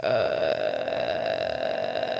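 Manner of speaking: very low, drawn-out creaky "uh"; stunned groan. Retold or imitated. Annie hears dad will marry, stunned groan. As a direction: let out a long mock-horrified low groan. Retold stunned reaction to marriage.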